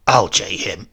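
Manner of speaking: low, loud mischievous growl; gleeful threat. A narrator's reading. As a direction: relish it like a wicked old rogue. Old man's sudden threat.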